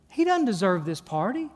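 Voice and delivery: ordinary delivery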